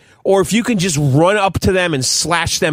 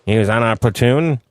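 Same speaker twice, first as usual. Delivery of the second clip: lightly voiced old-time army buddy; old-timey wartime character; 1940s war veteran; 7 semitones lower, faster than usual. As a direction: reminisce like a forties serviceman.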